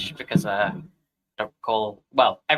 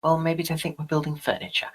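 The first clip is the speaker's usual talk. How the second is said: whispering; 2 semitones higher than usual. Acted. Secret talk in the street.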